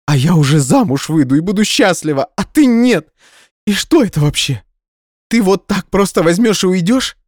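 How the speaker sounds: high, loud hurt rant; tearful tirade. Acted. Dumped girlfriend raging at Dima, high loud tearful rant. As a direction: rage through tears.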